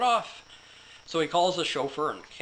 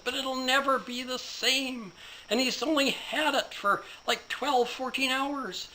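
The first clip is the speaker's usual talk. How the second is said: high-pitched indignant ranting; 8 semitones higher than usual. Acted. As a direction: whine and rage about the ruined supercar. Ranting at police officer.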